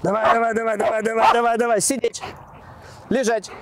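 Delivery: high, eager commands to a dog; excited dog commands. Real, not acted. Owner urging his dog.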